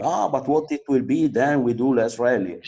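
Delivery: quoting the grumblers in an agitated high voice; mock fretting. Mimicking people fretting about fewer rallies.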